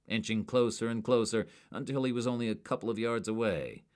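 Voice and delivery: ordinary delivery, nothing marked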